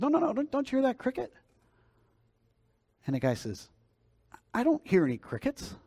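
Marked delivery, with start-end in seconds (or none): 0.0-1.3 very high, urgent mock insistence; insistent questioning. Missionary insists in acted story quote. 4.5-5.7 high-pitched, puzzled businessman; baffled denial. Acted. Businessman denies it in acted quote.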